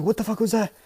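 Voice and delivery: high-pitched startled reaction; mock startled. Reviewer voices crew after the blast, mock startled. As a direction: play the baffled crew, pitched right up.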